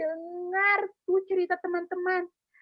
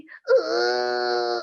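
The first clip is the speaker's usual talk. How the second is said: long exaggerated sobbing wail; mock sad wail; 3 semitones higher, 5 dB louder than usual. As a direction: pretend to bawl with sadness. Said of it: "sedihnya sedih banget".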